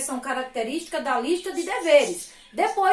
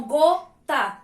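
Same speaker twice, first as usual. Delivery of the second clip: "gota" slowly split in two; syllable by syllable; 4 dB louder than usual. Shown as a demonstration. Teacher completing the word, "gota" in separate syllables. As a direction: say "gota" as go, pause, ta. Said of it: "Quantas sílabas".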